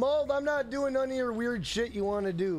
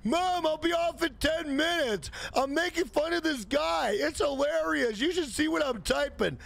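put-on higher, whining teenage son; whiny kid voice; 6 semitones higher than usual. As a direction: plead like a spoiled kid. Basement troll answers his mom.

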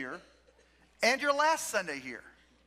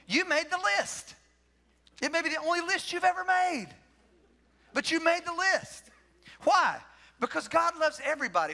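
higher, building preacher's call; rising preaching fervour; 6 semitones higher than usual. Acted. Pastor tells the congregation they made the list, fervent preaching. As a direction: lift it up and press the point home.

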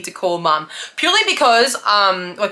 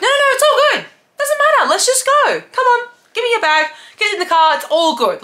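much higher, soothing and brisk; calm her down and hustle her along; uncle reassuring; 4 dB louder than usual. Retold or imitated. Uncle picking her up, soothing her gently. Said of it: "And he was like".

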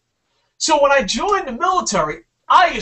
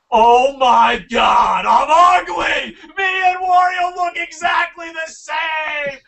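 loud, slow hammed-up horror, higher; mock shocked realization; 6 semitones higher, 5 dB louder, slower than usual. Realizing he looks like Wario, mock horrified shout. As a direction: shout it like the truth just hit you.

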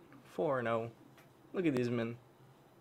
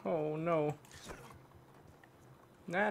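usual speaking voice

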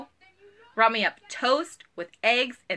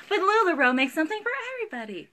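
quick higher-pitched sales pitch; mock sales line; 7 semitones higher, much faster than usual. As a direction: rattle off the company line in a bright pitch. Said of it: "y'all know I'm gonna say".

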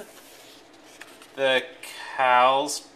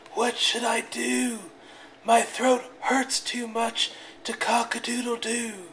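sore rooster; 7 semitones higher than usual. A narrator's reading. Sore-throated rooster voiced.

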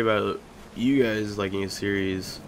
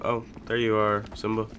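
normal talking voice

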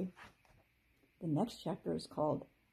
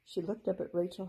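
ordinary delivery, nothing marked

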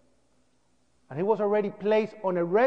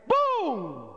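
a sudden shouted boom, mimicking the blast; imitated explosion; 6 semitones higher than usual. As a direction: burst out with the noise of the explosion. Retelling the explosion he heard.

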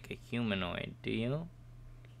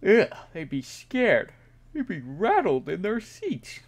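higher, louder dramatic flourish; mock dramatic; 9 semitones higher, 8 dB louder than usual. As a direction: ham up the horror. Joking about followers' reaction.

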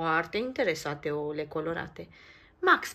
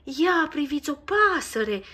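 high, louder excited cry; excited child; 8 semitones higher, 9 dB louder than usual. Acted. A child spots the bird in the tree, excited cry. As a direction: point it out with delight. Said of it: "exclamă unul dintre copii".

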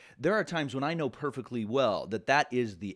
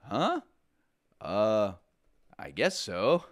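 puzzled French listener; 2 semitones lower, slower than usual. Retold or imitated. Teacher imitating a puzzled Paris local, mildly baffled. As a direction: lightly voice a confused local. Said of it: "What the French person actually heard was".

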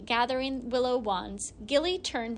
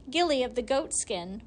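plain reading voice

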